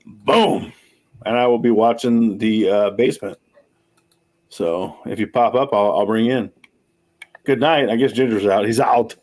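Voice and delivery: ordinary talk, nothing marked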